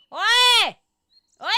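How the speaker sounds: loud, high mock British holler; very British accent. Shown as a demonstration. Streamer testing a very British accent, loud mock British shout. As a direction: call out like a hammed-up Brit. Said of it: "very British accent".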